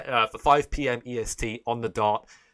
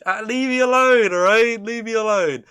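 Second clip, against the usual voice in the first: high, loud playful whine; mock protest; 11 semitones higher, 10 dB louder than usual. Streamer to cringing viewers, mock-wounded outcry. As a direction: cry out in mock indignation.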